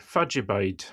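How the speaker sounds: Aberdonian Doric way of saying where do you live; Aberdonian accent. Shown as a demonstration. Aberdonian host showing the local phrase, Aberdonian accent. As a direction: run it together as one word, Fadjabide, the Aberdeen way. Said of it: "you would say".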